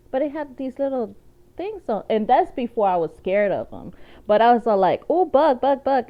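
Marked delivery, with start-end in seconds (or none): 5.1-6.0 higher-pitched, mildly excited exclamation; excited child at a bug. Retold or imitated. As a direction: act out being thrilled by a bug. Acting out childhood delight at a bug.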